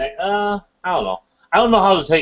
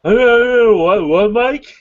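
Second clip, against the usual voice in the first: surprised; 7 semitones higher, 6 dB louder, much slower than usual. Real, not acted. Shocked at a friend's joke.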